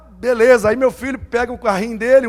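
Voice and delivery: ordinary talk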